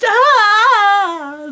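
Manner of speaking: high, loud blissed-out ah; a dazed moan; drugged sigh.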